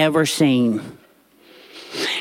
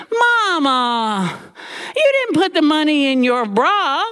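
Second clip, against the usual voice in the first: pitched-up disbelieving grown daughter; mock daughter scolding; 8 semitones higher than usual. Daughter reproaching her mother about the bra, disbelieving. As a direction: chide your own mother.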